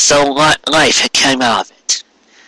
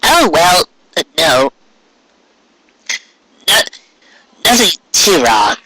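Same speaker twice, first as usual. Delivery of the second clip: polite hedging; 5 semitones higher than usual. A narrator's reading. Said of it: "tried to phrase it politely".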